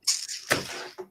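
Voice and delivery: long, breathy sigh; heavy sigh. Real, not acted. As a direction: let out a weary breath. Lamenting the team's failure.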